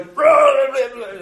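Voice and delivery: loud, high mock demon roar; demon roaring gibberish. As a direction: blurt a burst of monster babble. Imitates the raging demon's noise.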